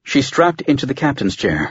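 usual speaking voice

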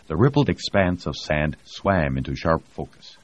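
ordinary delivery

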